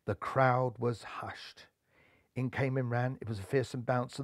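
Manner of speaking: hushed suspense. A narrator's reading. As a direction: drop to a tense hush. Tense hush before final ball.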